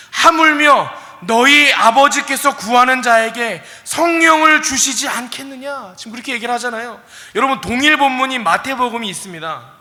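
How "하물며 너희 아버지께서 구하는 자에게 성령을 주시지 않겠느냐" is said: loud, high and slow proclaiming of the verse; preaching scripture. Acted. Preacher proclaiming Jesus' words to the church, full force. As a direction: thunder the verse out to the congregation.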